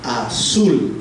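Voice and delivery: "Azul" with a light "A" and strong "zul"; stress on SUL. Shown as a demonstration. Teacher contrasting weak and strong syllables, "Azul" stressed at the end. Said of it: "suena más fuerte".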